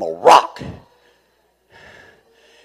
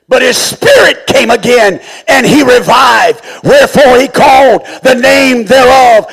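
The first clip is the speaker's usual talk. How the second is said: loud high preaching shout, pleading with God; preaching at full force; 13 semitones higher, 13 dB louder than usual. Acted. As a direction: cry the prayer out from the pulpit, high and loud. Preacher reading scripture at full force.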